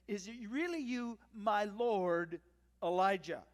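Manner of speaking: loud, reverent greeting, pausing before the name; awed and deferential, the title rung out loud. Acted. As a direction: address a revered master with deference. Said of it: "Notice the deference with which he greets".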